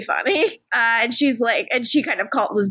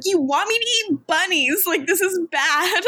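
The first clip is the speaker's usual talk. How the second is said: mock yell; 7 semitones higher than usual. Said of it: "she's, like, yelling".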